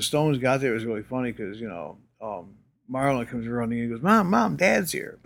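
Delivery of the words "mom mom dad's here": higher-pitched, mildly excited retold shout-out without real volume; lightly voiced excited kid; excited child's call. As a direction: imitate a kid running to tell mom. Child running in, announcing dad's arrival.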